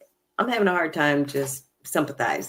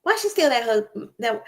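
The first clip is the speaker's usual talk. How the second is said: slightly raised, pointed question cut off; retold incredulous question; 7 semitones higher than usual. Recapping the cast member's opening line, lightly raised incredulous quote. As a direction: lightly replay the blunt question, cutting off mid-word. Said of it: "she was like".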